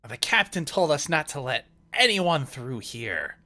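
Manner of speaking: loud, high, forceful pirate refusal; pirate guard's challenge. Acted. Pirate bars the party's way.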